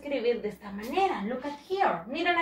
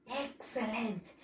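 usual speaking voice

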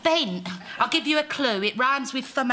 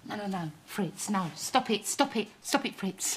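soft, urgent repeated protest; low, anxious undertone; hushed pleading; 6 semitones lower, 8 dB quieter than usual. Acted. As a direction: quietly urge him to stop. Covertly begs him to stop.

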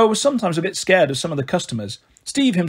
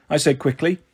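usual speaking voice

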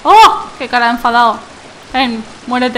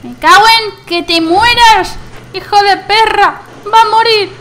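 louder, higher furious cursing; angry yell; 6 semitones higher, 4 dB louder than usual. Real, not acted. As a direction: curse at the enemy. Fighting enemy. (gritos de rabia)

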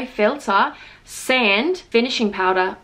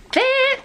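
fit jumps much higher; high stress on fit; 13 semitones higher than usual. Acted. Praising her makeup, high stress on fit. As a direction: pop the word fit. Emphasised word.

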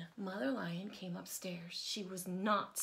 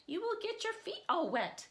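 higher, calling across the yard; called out; 12 semitones higher, 4 dB louder than usual. A narrator's reading. Mother Lion calling to Johnny in the puddles, higher. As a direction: call to him from afar. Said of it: "called Mother Lion".

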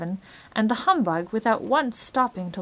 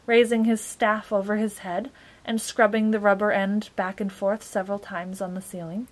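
ordinary delivery

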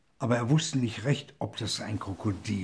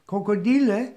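higher, louder summoning call; 10 semitones higher, 6 dB louder than usual. A narrator's reading. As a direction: summon the crocodiles. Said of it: "rief er". (hoher, lauter Ruf an die Krokodile)

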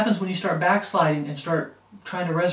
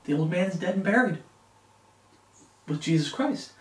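ordinary talk, nothing marked

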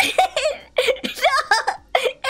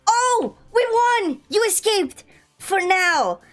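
high, slow triumphant cheer; excited cheer; 8 semitones higher, much slower than usual. Real, not acted. Winning the game.

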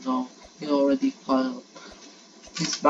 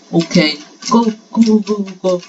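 loud, urgent cheer-on at the start; urgent shouting; 3 semitones higher, 7 dB louder than usual. Real, not acted. Player urging on the new round, urgent shouting. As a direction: shout the racer on, loudly.

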